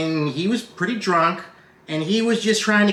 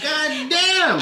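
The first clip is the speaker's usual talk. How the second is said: high mock amazement; stunned; 11 semitones higher than usual. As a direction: gasp it out in disbelief. Reacting to outburst.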